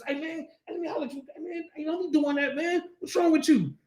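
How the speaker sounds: high, hushed mock reproach; scared brother. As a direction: pull him aside in an acted nervous undertone. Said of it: "'Cause he was scared".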